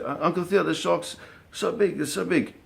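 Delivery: put-on scared kid. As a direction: stretch it out like a frightened boy. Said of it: "Devin's like".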